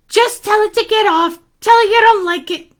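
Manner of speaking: put-on high, fast kid voice; urging boy Aaron. Boy advising man about hair, high and quick. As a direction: rattle off eager advice.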